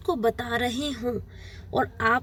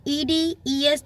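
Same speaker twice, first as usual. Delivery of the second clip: slowly spelled letters; much slower than usual. Shown as a demonstration. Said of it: "जब भी हम suffix use करते हैं".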